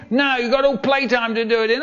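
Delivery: high, rapid refusal; strict teacher. Acted. Teacher refusing the whiny kid, strict. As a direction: rattle it off as a scolding teacher. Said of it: "a teacher who was so strict".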